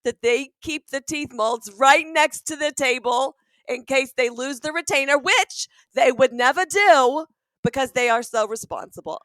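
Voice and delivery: hammed-up New York mom, bursting with pride; New York accent. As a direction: brag proudly in a big New York accent. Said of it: "you turn us into New Yorkers".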